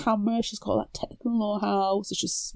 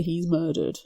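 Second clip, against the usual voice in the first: ordinary talk, nothing marked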